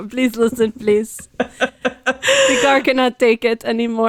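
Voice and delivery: slow, hammed-up European accent with laughter; mock pleading accent, laughing. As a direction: plead for the car in a bad accent. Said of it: "Our accents are so off".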